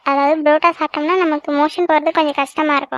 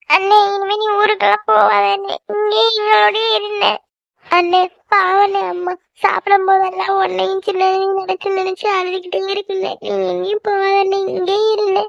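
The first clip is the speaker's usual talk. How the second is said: crying plea; 5 semitones higher than usual. Acted. Little sister begs big brother not to leave, crying. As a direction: beg through tears, dragging the words out. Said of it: "சரி குட்டி, அழாத".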